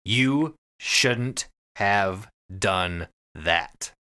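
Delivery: usual speaking voice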